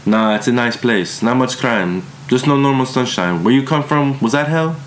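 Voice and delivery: a hint of a strong German accent. A narrator's reading. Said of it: "She had a strong German accent".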